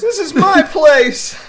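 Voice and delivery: very high, loud squeaky critter voice breaking into laughs; tiny outraged gremlin, slow and cracking up; tree dude voice. Retold or imitated. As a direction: possessive creature squeal through laughter. Imitating the possessive tree dude.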